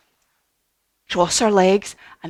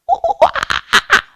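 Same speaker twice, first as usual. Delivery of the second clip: very high, loud monkey hoots; playful chimp calls for children; monkey sounds; 8 dB louder, much faster than usual. Shown as a demonstration. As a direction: hoot and screech like a monkey. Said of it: "do some monkey sounds".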